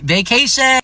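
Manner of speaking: high, loud mock fanfare; mock grand. Vlogger hyping up a beach trip, high and loud. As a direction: announce it like a big deal.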